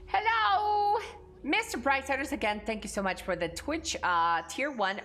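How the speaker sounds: thanking a subscriber in a hammed-up Russian accent; mock Russian accent. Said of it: "kind of a good Russian accent".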